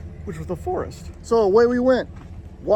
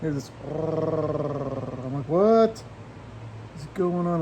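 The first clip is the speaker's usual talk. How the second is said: suspenseful hush; 3 semitones lower, 5 dB quieter, slower than usual. A narrator's reading. Scary rustling in bushes.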